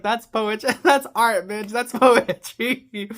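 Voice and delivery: high, loud gush broken by laughs; ecstatic gushing. Retold or imitated. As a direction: rave about it like it moved you. Her own ecstatic reaction retold.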